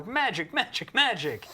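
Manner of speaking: high, loud mock battle cries; mimicked spell calls. Mocking larpers on a field, high hammed-up calls. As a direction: imitate a larper casting frantically. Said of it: "people with boffer weapons going like".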